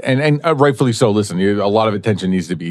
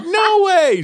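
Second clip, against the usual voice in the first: high laughing no way; disbelieving and amused; surprised disbelief. Retold or imitated. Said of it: "She's like".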